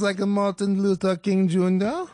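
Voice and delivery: mock a German accent with a camp lilt. Comic redoing the German man's question, camp mock German accent.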